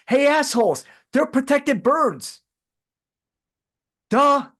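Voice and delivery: high, slow, sneering "hey, assholes"; mock exasperated retort. Host mocking people upset about geese, sarcastic and high. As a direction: talk down to them like idiots. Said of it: "It's like".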